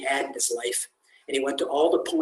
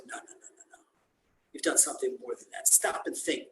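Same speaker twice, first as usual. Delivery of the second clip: soft, breathy no no no, then quick; hushed urging; 7 dB quieter, faster than usual. Acted. Rabbi quietly pressing the janitor to think. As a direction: coax him gently to remember.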